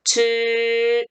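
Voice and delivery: 즈 held long with an r-coloured curl; retroflex zhi. Shown as a demonstration. Teacher models the correction, curled-tongue 즈. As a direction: curl the tongue slightly through a level 즈. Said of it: "리을 발음 있어야 돼요".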